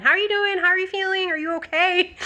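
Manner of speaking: high sing-out nurse questions; imitated checking-in nurse.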